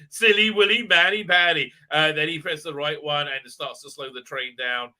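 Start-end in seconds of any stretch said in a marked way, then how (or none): 0.1-1.7 mocking baby talk. Mocking Dan as silly.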